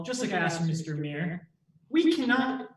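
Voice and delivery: normal talking voice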